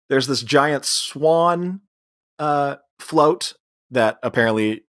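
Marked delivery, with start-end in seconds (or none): none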